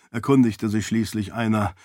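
ordinary delivery, nothing marked

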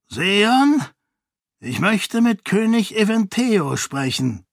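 high, imperious monk voice; a fanatic priest giving orders, pitched high; zealous monk. A narrator's reading. Dilaf addresses the Seon. (hohe, herrische Mönchsstimme)

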